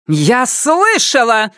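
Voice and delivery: high, slow enraged cry; furious outburst. A narrator's reading. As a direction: flare up in fury. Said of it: "взвилась взбешённая заведующая".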